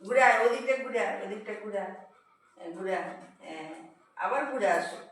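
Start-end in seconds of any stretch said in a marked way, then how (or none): 2.6-3.7 laughing. Real, not acted. Laughing at the pet.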